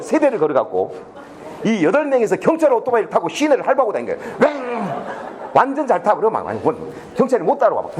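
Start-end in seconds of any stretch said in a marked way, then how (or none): 4.4-5.0 drawn-out motorbike revving noise; imitated engine roar. Students race police motorbikes downtown, imitated engine roar. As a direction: voice a motorbike tearing away.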